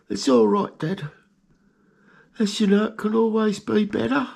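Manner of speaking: squeaky high little-rat voice, cheerful; pet rat's voice. Pretend. Pet rat Greggy answering his owner, squeaky and high. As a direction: answer Dad as a tiny proud rat.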